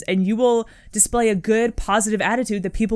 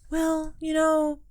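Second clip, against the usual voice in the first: meek, high-pitched voice imitating a timid email; mock a hesitant beginner, pitched way up; unsure, apologetic; 9 semitones higher than usual. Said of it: "vibe from the email".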